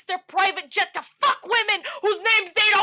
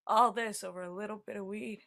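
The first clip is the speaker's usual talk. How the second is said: 1 deflated aside; 7 semitones lower, 11 dB quieter, faster than usual. Acted. Deflated after outburst.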